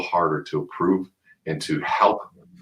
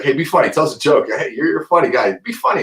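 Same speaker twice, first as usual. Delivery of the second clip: demanding viewer; 9 semitones higher, 7 dB louder than usual. Retold or imitated. Viewers demanding jokes, quoted.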